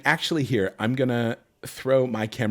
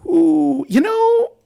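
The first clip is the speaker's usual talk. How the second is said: acting out own choice; 5 dB louder than usual.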